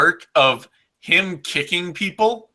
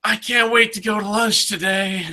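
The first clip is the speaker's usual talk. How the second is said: put-on Dutch accent; 7 semitones higher than usual. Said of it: "With that heavy Dutch accent".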